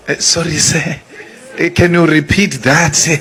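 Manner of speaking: timidly begging the sir's pardon, lightly voiced; mock submissive. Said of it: "this submissive attitude".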